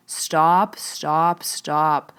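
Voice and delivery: half-hearted mock scream of stop; retold screaming. The son begging his father to stop, mock scream. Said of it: "he's literally screaming at me to scream at you".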